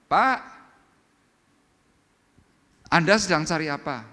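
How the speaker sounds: loud, high call down the street; a housewife calling loudly to a passing beggar. Retold or imitated. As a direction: shout to get the old man's attention. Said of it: "si ibu teriak".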